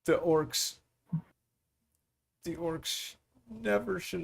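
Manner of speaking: grieving mother. Acted.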